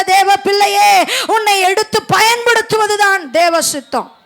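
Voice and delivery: exhortation building in force and pitch; rally the listeners, pushing harder line by line; impassioned preaching. Real, not acted. Preacher urging believers not to lose heart, preaching with mounting force.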